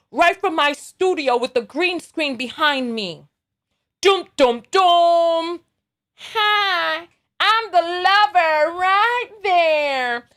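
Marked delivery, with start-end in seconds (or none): 4.0-5.6 dramatic sting. Retold or imitated. 6.1-10.3 slow put-on voice of a dubious guest; mock anonymous guest. Vlogger playing the fake lover guest on stream, slow put-on voice.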